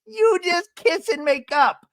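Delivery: put-on high, slow little-boy voice; Ben as little kid. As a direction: scold the grown-ups like a small child. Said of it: "Ben, to come out and be like".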